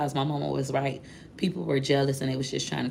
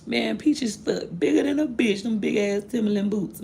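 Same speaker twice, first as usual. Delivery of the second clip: mocking jeer; mocking cousin; 6 semitones higher than usual. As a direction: tease her big feet in a higher voice. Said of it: "So he said again".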